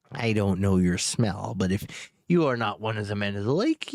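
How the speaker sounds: low, menacing dragon voice. Acted. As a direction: threaten like a vengeful dragon king. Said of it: "with the dragon voice".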